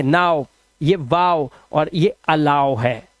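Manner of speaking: नाउ and अलाउ said slowly with a wide "au"; slow teacher demo of the au sound. Shown as a demonstration. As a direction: stretch the au in नाउ, वाओ, अलाउ. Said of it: "ओडब्ल्यू को आउ से पढ़ते हैं".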